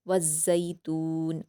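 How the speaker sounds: slow, careful Quranic reading; slow recited verse; tajweed recitation. Shown as a demonstration. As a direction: draw the verse out slowly. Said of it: "मारूफ पढ़ते हुए".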